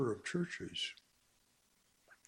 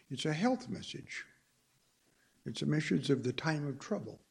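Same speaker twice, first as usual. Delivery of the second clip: ordinary talk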